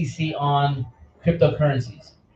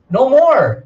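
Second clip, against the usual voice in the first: shouted high and loud, cutting in; rallying shout against fear; sudden shout; 8 semitones higher, 7 dB louder than usual. Real, not acted. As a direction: burst out with it.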